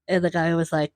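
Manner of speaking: ordinary talk, nothing marked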